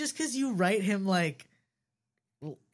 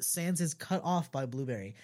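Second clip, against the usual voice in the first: plain reading voice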